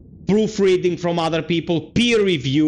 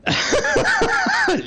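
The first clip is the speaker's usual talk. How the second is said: high laugh. Real, not acted. Laughs at own quote.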